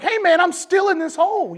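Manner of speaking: loud, high-pitched call after someone leaving; retold yell for attention; acted scream. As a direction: shout up from down below, acting it out. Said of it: "the guy's screaming like".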